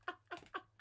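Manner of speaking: high, strained through laughter; crying laughing. Real, not acted. Streamer breathless from laughing, high strained oh my God. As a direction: squeeze it out while dying laughing.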